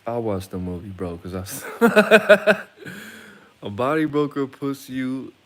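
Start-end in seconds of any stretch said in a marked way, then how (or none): 1.7-3.6 loud high burst of laughter; big real laugh. Real, not acted. 3.6-5.3 laughing. Real, not acted. Repeats line through laughter.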